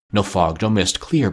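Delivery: ordinary delivery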